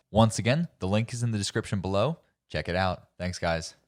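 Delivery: upbeat sponsor read to the camera. Acted. Host pitching Instacart to viewers, upbeat ad read. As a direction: sell the grocery app like a host.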